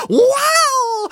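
loud, very high bird cry; peacock shriek. Acted. Storyteller voicing upset barnyard peacock, shrieking. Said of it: "the peacock was shrieking".

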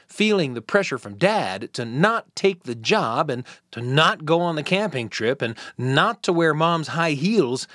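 plain reading voice

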